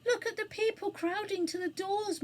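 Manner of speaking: high, despairing wail; moaning. A narrator's reading. Anthea watching crowds jam the doors, moaning. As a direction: moan in helpless alarm. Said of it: "moaned Anthea".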